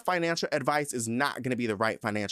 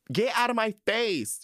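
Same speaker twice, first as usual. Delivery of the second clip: high, heated dismissal; high exasperated outburst; 10 semitones higher than usual. Real, not acted. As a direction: shoo them away exasperated. Ranting at advice givers.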